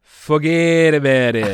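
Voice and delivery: loud, stretched New York "fuhgeddaboudit"; mock New York accent. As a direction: drag it out like a New York wiseguy. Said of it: "I'm from New York".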